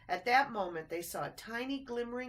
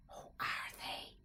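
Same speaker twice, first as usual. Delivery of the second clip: frightened whisper; 6 dB quieter than usual. A narrator's reading. Ted hiding from rats, in a whisper. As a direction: hush so the rats can't hear. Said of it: "whispered Ted".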